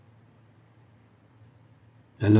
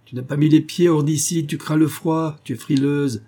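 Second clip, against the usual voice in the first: raised, higher-pitched reproach; heated, accusing old master; angry scolding; 6 semitones higher than usual. A narrator's reading. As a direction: berate a lazy servant. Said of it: "en bégayant de colère".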